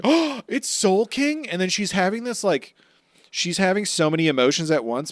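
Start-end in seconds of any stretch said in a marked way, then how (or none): 0.0-1.4 high, slow, amazed cry; mock amazed realization. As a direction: draw it out in wonder at Soul King. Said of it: "she's like".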